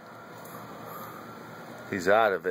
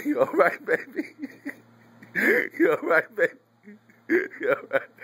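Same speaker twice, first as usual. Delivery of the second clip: high-pitched, laughing through the words; laughing pet talk; 6 semitones higher than usual. Real, not acted. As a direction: giggle while asking the dog if it's okay. Laughing while checking on bitten dog.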